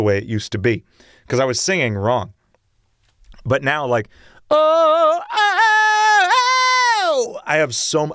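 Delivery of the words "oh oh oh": climbing sung oh, very high; extended high range. Shown as a demonstration. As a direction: show off the new top octave. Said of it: "almost an extra octave on top".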